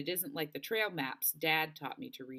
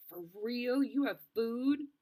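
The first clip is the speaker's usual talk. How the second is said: higher, slow amazed disbelief; a girl hardly believing there's food; 7 semitones higher, 3 dB louder, slower than usual. Acted.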